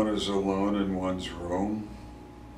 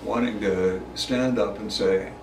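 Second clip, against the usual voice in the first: ordinary talk, nothing marked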